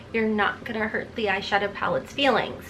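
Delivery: ordinary talk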